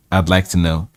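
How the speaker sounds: usual speaking voice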